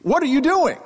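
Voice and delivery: sudden high, startled cop's question; high-pitched alarmed officer demanding an answer; policeman in perfect English. Retold or imitated. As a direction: bark it out like a surprised policeman. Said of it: "in perfect English".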